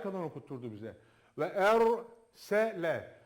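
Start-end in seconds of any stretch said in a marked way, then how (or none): 1.4-3.1 slow chanted recitation; Quran recitation. Shown as a demonstration. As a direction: recite the verse slowly, with tajweed. Recites verse. (yavaş tecvidli tilavet)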